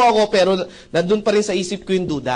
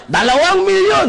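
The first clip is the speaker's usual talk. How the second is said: mock hype shout; 13 semitones higher, 7 dB louder than usual. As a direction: bellow it like a hype man working a seminar crowd. Seminar speaker hyping the crowd.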